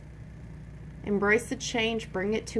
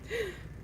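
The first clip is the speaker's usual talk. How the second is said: sharp gasp of pain; 13 semitones higher, 10 dB quieter than usual. Real, not acted. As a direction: gasp from a sudden cramp. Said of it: "I'm cramping today".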